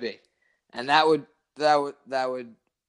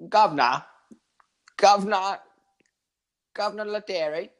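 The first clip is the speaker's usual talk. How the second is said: hammed-up British greeting, rising higher; attempted English accent; 6 semitones higher, 4 dB louder than usual. Shown as a demonstration. Friend answering an accent challenge in an attempted English accent. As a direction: try out a mock British accent. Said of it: "Let me see your English accent".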